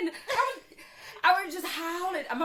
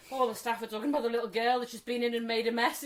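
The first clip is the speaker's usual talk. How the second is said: ordinary delivery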